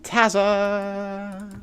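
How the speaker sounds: hammed-up cartoon impression; Peter Griffin. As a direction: stretch it out high like a goofy cartoon character. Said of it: "pretty good Peter Griffin impression".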